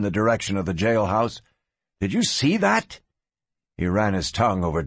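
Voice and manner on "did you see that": nervous. Acted. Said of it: "Rhett Cody said nervously".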